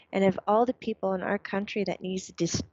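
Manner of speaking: normal talking voice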